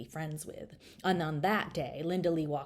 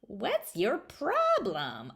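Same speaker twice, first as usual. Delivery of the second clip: slow, higher, strange frog voice; grubby groggy voice; 6 semitones higher, much slower than usual. A narrator's reading. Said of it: "a grubby, groggy, strange voice asked".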